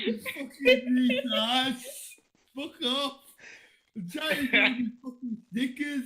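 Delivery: put-on high-pitched, irritated British accent. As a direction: put on an indignant Brit, pitched well up. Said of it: "Why is it British?".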